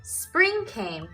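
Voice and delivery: plain reading voice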